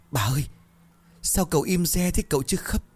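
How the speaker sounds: high-pitched, tearful maid's voice; tearful whimper. A narrator's reading. Young maid asks the midwife why baby is silent, tearful whimper. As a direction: pipe it up high, close to crying. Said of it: "một con sen mếu máo".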